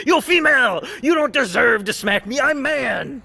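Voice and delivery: high, loud mock caveman shouting; mocking migrant voice. Commentator mocking the man who was smacked, high mock yell. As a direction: ham up a crude angry man yelling. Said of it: "She's like".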